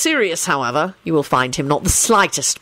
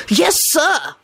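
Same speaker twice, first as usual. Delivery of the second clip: higher-pitched, eager assent; enthusiastic; 10 semitones higher than usual. A narrator's reading. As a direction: answer with keen enthusiasm. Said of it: "Lupin said enthusiastically".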